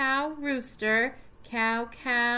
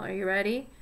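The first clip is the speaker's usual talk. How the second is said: ordinary talk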